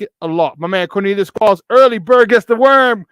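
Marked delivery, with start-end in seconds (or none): 1.7-3.1 loud and slow. Acted. Shouting out early chatter.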